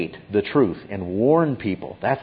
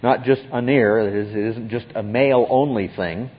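ordinary talk, nothing marked

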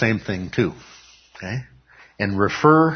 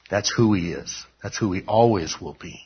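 ordinary talk

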